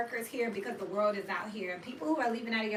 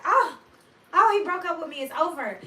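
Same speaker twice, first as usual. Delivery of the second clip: higher, much louder hammed-up wail; mock heartbreak; 8 semitones higher, 12 dB louder than usual. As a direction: overplay being dumped, loud and high. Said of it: "I was like".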